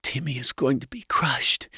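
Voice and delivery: high wife voice, mildly tearful mutter; understated, high-pitched distress; muttered worry. A narrator's reading. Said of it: "she muttered".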